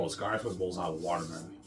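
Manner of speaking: ordinary talk, nothing marked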